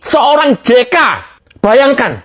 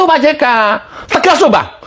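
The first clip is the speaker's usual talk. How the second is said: ordinary delivery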